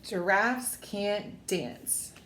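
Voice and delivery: plain reading voice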